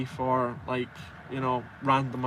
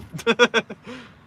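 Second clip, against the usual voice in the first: burst of laughter; 8 semitones higher, 8 dB louder than usual. Real, not acted. Laughs at own chin joke.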